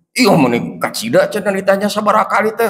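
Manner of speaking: ordinary talk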